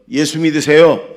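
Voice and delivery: evangelistic call. Shown as a demonstration. Preacher has congregation try it, loud evangelistic call. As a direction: call it out for everyone to repeat. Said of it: "해보세요".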